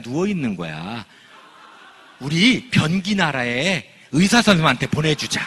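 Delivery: hammed-up mom soothing her child with a fib; sweet storybook mom. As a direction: coo the fairy tale gently. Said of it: "황당형 엄마들은 어떻게 하는 줄".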